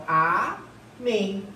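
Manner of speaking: amém drawn out slowly as a-MÉM; syllable by syllable. Shown as a demonstration. Teacher showing the last syllable is tonic, amém slowly split. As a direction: split amém in two, ending on a long MÉM. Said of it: "a sílaba tônica aqui é a última".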